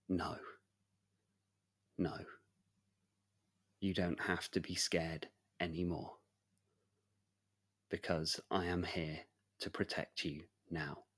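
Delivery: hushed and tender protector; gentle reassurance. Acted. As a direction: soothe a frightened child. Knight comforts scared child.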